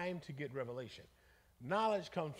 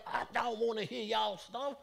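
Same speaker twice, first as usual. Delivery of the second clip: overwhelmed Bruce; 6 semitones higher, 5 dB louder, slower than usual. Retold or imitated. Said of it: "Bruce is, like, freaking out, like".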